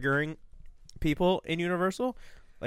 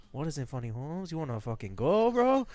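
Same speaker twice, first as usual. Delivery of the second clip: mock Chicano accent. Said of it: "doing a Spanish accent".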